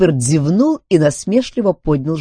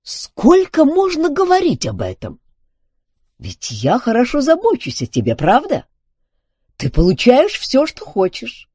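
high-pitched exasperated protest; indignant; 12 semitones higher, 4 dB louder than usual. A narrator's reading. Duncan scolds the robot demanding credit, indignant. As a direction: remonstrate with raised pitch. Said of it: "подскочил от негодования".

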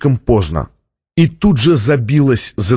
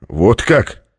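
coolly condescending question; understated cold superiority; condescending, ice-cold. A narrator's reading. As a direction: ask it with a touch of icy disdain. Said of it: "снисходительно произнёс Стратмор холодным, как лёд, голосом".

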